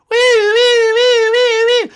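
high giddy shrieking wee; playful squeal. Pretend. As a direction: squeal with glee. Playful noise while chasing.

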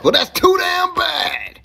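high, drawn-out mock yell; mock outraged retort. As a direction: fire back in an exaggerated high holler. Loud mock retort to complaint.

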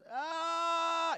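high, loud hospital patient's scream; imitated scream of pain. Preacher imitating a patient down the hall, sharp pained scream. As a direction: scream out as if in agony. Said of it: "they're just".

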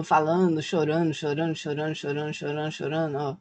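low drone fading away softly; dismissive repetition. Real, not acted. Creator mocking the community's endless whining, weary fading drone. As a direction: repeat it monotonously, sinking in volume.

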